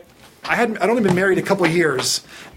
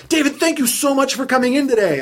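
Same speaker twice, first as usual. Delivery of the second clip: higher, gushing thanks; gushing manager; 8 semitones higher than usual. Retold or imitated. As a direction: pour out thanks, overly sweet. Voicing Gina the grateful manager.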